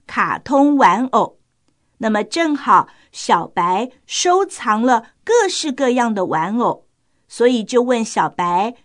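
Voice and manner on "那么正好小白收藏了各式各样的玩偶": plain reading voice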